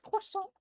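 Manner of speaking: CROISSANT as a very high mock-French squeal; silly croissant. Shown as a demonstration. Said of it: "the silly way of just saying".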